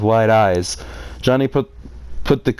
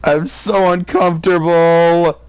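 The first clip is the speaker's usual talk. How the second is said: higher, louder, slow cringing whine; squirming discomfort; 6 semitones higher, 4 dB louder, slower than usual. Real, not acted. Reader cringing at the creepy uncle, high slow cringing whine. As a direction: drag it out like you want to hide.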